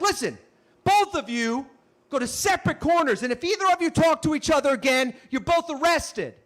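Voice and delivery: imitating an officer's firm order; stern police officer. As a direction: lay down the law to two troublemakers. Officer scolds the two men.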